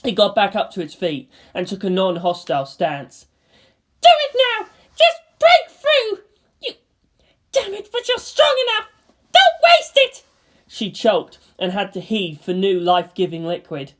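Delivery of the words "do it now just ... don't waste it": high, loud sobbing shouts; a weeping girl screaming orders; crying furious girl. Acted. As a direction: scream through tears. Asuka crying out.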